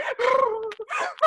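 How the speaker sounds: high, wild laughing sob; mock breakdown sob. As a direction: let a half-laugh half-sob burst out. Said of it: "like, ugly crying".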